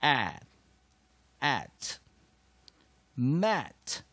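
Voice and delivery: loud, open short A in "at", then "mat"; short A demo. Shown as a demonstration. Teacher drilling short vowel A, loud separated at, mat. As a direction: build "at" into "mat" with clear pauses. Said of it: "short vowel A".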